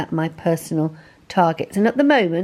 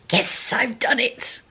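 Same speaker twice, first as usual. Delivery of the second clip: higher-pitched, pleased little cheer; triumphant; 5 semitones higher than usual. Real, not acted. Celebrates meeting her YouTube challenge.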